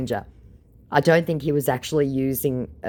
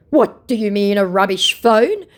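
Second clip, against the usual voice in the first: affronted; 7 semitones higher, 7 dB louder than usual. Acted. Scammer retorts to being told his phone is rubbish, affronted. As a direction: act the offended scammer, stretching the end.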